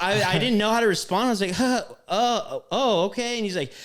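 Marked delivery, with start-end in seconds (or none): 1.5-3.4 higher-pitched, halting stammer of shock; stunned response. Retold or imitated. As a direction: act out being caught off guard, fumbling for a reply. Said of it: "like, in shock".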